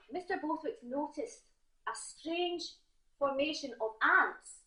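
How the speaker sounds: normal talking voice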